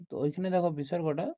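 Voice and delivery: ordinary talk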